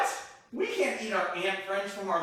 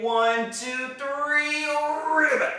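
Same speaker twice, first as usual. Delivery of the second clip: excited count; 9 semitones higher, 6 dB louder, slower than usual. Pretend. Counting up to the jump.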